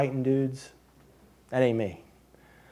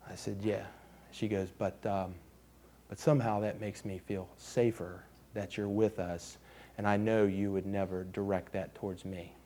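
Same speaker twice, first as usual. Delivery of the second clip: soft quiet retelling; 6 semitones lower, 13 dB quieter than usual. Retold or imitated. Daughter's quiet confession retold.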